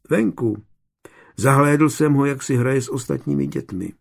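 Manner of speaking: ordinary delivery, nothing marked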